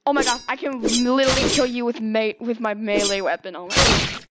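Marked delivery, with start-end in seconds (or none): 3.6-4.3 breathy shrieking scream of panic. Real, not acted. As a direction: scream it out in fright. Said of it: "You heard my scream there".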